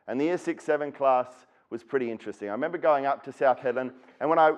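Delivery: ordinary delivery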